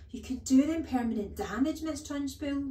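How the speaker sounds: a hint of a distressed teacher protesting; crying out. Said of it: "Miss Honey cried out".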